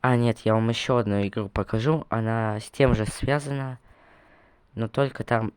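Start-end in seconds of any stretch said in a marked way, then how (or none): none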